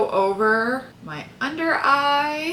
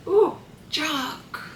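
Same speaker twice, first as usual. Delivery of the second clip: lightly put-on breathy English film-star coo, higher; a hint of a swooning period-drama heroine; Keira Knightley impression; 6 semitones higher than usual. Vlogger doing Keira Knightley while straightening her hair, mock swoon. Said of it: "That's my Keira Knightley impression".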